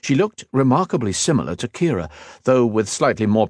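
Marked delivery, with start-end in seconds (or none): none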